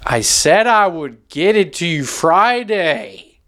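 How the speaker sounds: slow, defensive tenant voice; a hint of a tenant pleading; tenant to landlord. Tenant answering the landlord about late rent, slow and defensive. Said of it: "Like a landlord after you don't pay".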